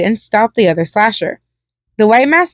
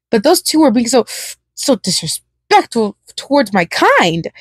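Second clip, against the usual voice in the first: hateful hiss; 2 semitones higher than usual. A narrator's reading. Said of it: "he hissed hatefully".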